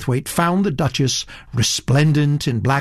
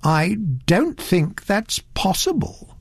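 slow, thoughtful; 7 semitones higher, slower than usual. Acted. Satterthwaite doubting the Duchess, slow and thoughtful. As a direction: say it slowly, turning it over. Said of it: "said Mister Satterthwaite slowly".

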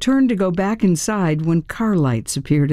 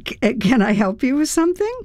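flustered; 9 semitones higher, faster than usual. A narrator's reading. Startled narrator greeting visitor.

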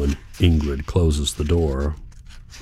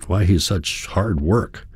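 ordinary delivery, nothing marked

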